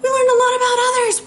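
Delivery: jokey high-pitched take; high squeaky. Pretend. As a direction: toss it off in a silly high voice. Repeated line, second take squeaky.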